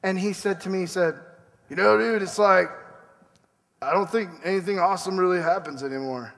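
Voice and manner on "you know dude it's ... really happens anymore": lightly voiced laid-back dude, a touch fast; a hint of the slacker cashier; Corey impression. Preacher imitating Walmart friend Corey, lightly voiced slacker dude. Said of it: "He talks like this".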